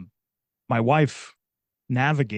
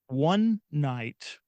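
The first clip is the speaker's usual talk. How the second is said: ordinary delivery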